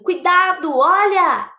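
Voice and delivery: sharp alert to watch out; warning cry. Shown as a demonstration. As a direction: shout a heads-up, high and loud. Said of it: "Eu posso expressar emoção advertindo".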